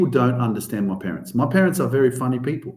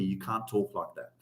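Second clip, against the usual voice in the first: low, soft dad telling off; a quiet hint of a scolding father; dad scolding his swearing son; stern dad; 7 semitones lower, 12 dB quieter than usual. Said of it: "he's telling me".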